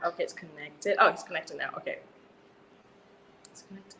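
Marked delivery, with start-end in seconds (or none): 3.5-4.0 whispered. Real, not acted. Aside while fixing the Bluetooth.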